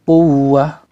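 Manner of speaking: quwwah, doubled w held and pressed. Shown as a demonstration. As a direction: push the w in quwwah. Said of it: "ditekan sedikit". (tekan huruf w di quwwah)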